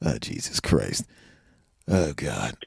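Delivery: low, slow quiet disbelief; muttered dismay. Retold or imitated. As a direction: mutter it under your breath in shock. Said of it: "I'm going".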